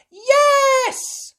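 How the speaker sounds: very high loud cry of yes; shout. Acted. Children answering Miss Rabbit, high loud shout. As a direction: yell it with glee. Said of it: "shouted the children".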